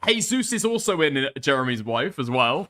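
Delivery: ordinary talk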